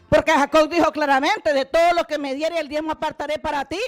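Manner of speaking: booming scripture quotation; forceful preaching. Real, not acted. Preacher cites Jacob's tithe vow to the congregation, very loudly and emphatically. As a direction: hammer the verse out loudly.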